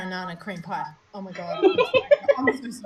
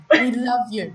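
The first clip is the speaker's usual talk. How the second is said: normal talking voice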